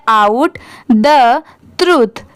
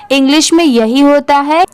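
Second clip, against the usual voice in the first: ordinary talk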